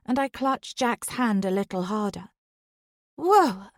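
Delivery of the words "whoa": higher, louder startled whoa; jolted by the car, blurt it out; startled exclaim. Acted. Dawn as the car suddenly jerks, startled exclaim. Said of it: "Dawn exclaims".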